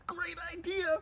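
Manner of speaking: very high, excited agreement; excited dog. Acted. Dogs agreeing to play in the park, excited. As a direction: jump at the plan in a high voice.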